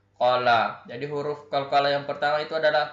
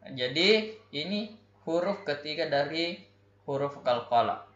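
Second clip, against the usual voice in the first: usual speaking voice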